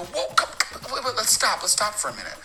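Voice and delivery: flustered protest. Retold or imitated. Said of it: "I go".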